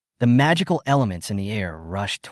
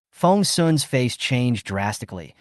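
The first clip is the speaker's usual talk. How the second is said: usual speaking voice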